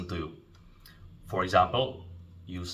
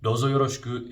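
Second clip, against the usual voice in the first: "Douzo yoroshiku" said louder and higher, flagged as dated; old-fashioned phrase; 5 semitones higher, 5 dB louder, faster than usual. Shown as a demonstration. Teacher warning students off an old phrase, said loud and high. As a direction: mark "Douzo yoroshiku" as the outdated choice. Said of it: "is a little bit older".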